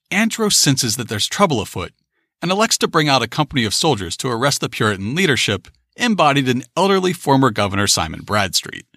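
usual speaking voice

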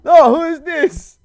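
confused pizza clerk, pitched high and loud; mock pizza guy. Acting out a prank call to a pizza place, loud high confused clerk. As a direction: bark back as the baffled clerk, loud and high. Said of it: "everyone calls, like, the pizza places".

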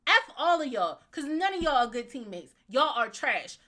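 mock telling-off. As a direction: chew them all out, loudly. Mock telling-off of the absent team.

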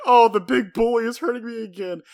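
put-on high whine of a kid faking hurt; mock whiny kid. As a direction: play the sly little brat crying wolf. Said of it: "doing the imp pervert thing".

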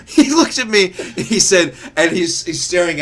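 high squeal of laughter through the words; can barely get it out for laughing; cracking up mid-story. Real, not acted. Laughing while retelling.

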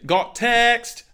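high, loud, hammed-up British catchphrase; bad British accent. Co-host mimics a Love Island contestant in a bad British accent. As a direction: belt it like a bad Brit reality-show star. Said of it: "I can't do a British accent".

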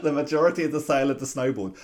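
laughing through the words; half laughing in disbelief. Real, not acted. Laughing at the lost sale.

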